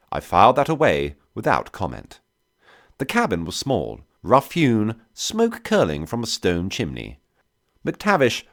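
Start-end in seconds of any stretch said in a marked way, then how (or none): none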